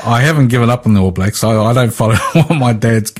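normal talking voice

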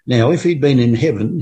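ordinary delivery

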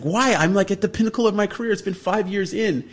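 high, loud, pleading disbelief; a retold cry of why me; exasperated why. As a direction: protest it like the dream is slipping away. Frustrated self-quote about career.

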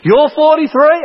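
lightly acted childlike astonishment; child's mock surprise. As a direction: repeat it back as an amazed little girl. Daughter's amazed reply, acted.

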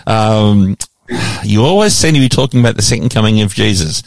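normal talking voice

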